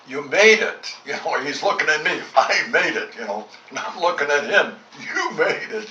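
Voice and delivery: joyful mock wonder, half laughing; excited reunion. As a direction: relive the joy of finding him alive. Emotional hospital reunion.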